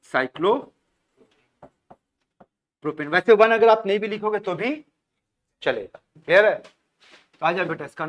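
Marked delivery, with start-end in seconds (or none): 0.1-3.1 very slowly stretched cyclopropane, each syllable held long; drawn-out prompt. Shown as a demonstration. Teacher slowly drawing out cyclopropane for the class to finish.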